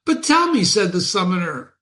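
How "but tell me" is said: eager question. Acted. Summoner asks fiend eagerly.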